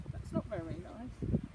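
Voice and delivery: ordinary delivery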